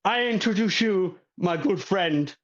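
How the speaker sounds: building to a high call of the name; host introducing his good friend; showman host. Acted. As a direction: introduce him grandly. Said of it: "And go".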